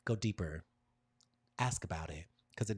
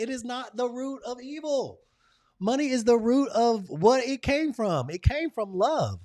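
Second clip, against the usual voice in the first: preaching; 10 dB louder than usual. Acted. Preaching about money.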